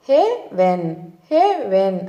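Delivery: హెవెన్ as హె, pause, వెన్; drawn-out first half of హెవెన్, then వెన్ apart; broken into syllables. Shown as a demonstration. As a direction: split హెవెన్ into two separate chunks for learners. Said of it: "విడదీసి పలకడం ద్వారా".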